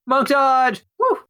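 high triumphant shout. Acted. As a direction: yell it like a battle cry. Calls out his move.

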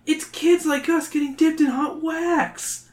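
put-on kid voice. A narrator's reading. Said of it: "one kid says".

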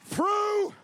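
"Through" shouted high and loud on its own; preacher punching "Through" as one word; emphasis on through. Shown as a demonstration. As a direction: hit "Through" hard and hold it. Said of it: "hear the emphasis".

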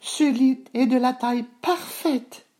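put-on very high, contented sleepy girl; high girl voice, nodding off; drowsy little girl. A narrator's reading. Boucle d'Or dozing off in small bed, drowsy high voice. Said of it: "marmonna-t-elle en s'assoupissant".

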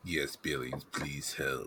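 low, soft polite request; Abner in character. Acted. Abner asking the guard for help out, low and soft. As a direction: ask the guard softly, low in pitch.

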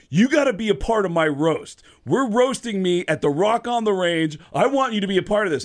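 high, loud, insistent pitch; excited invitation. Retold or imitated. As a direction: recruit him with urgent enthusiasm. Singer urgently invites comedian to roast.

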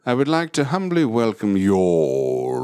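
slow, over-formal British host; mock British accent. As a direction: welcome them with hammed-up British formality. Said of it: "ask a British person to say".

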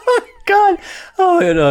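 high, loud, teary laugh; gasping for air after laughing; real breathless laughter; laughing to tears. Real, not acted. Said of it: "I've fully lost it".

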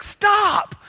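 retold furious yell of stop; angry yell. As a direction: bark it like you're charging at the birds. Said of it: "I got mad, man".

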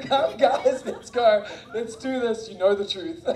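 rally reluctant helpers to the garden; cheerful team rally. Retold or imitated. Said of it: "So it was like".

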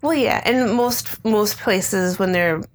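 ordinary delivery

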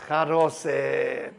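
"charoset" stretched very slowly; drawn-out reveal. Real, not acted. Teacher revealing the exciting part to students, drawn-out reveal. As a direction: savor "charoset", every syllable held long.

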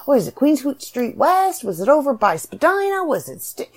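high, eager reader voice; light, animated recognizing voice; excited recognition. Retold or imitated. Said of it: "read that and go".

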